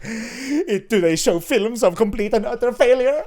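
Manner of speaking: put-on mopey French accent, quoted lightly; imitated French accent. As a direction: mimic a colleague's French Napoleon. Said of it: "His French accent was so good".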